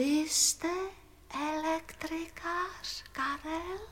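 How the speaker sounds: soft, slow singsong question; very quiet. Acted. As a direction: ask it in hushed, drawn-out singing tones. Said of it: "Neumí nahlas, je tichá". (tichá, táhlá, zpěvavá otázka)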